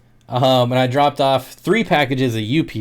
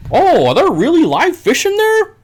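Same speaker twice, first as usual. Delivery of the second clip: much higher and louder, hammed-up amazement; mocking customer; 5 dB louder than usual. Mocking every customer amazed at live fish, much higher and louder. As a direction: squeal it like a gushing customer. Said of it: "I always get the".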